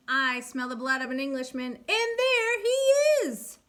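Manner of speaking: hammed-up giant shouting for kids; giant roar. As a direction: stretch out the last words like a giant spotting his prey. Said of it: "roared the giant".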